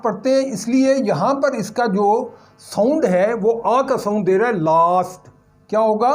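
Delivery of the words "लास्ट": "लास्ट" with a long open aa, lower and careful; model pronunciation. Shown as a demonstration. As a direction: sound out "लास्ट" as laast. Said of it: "आ की आवाज़ देनी है".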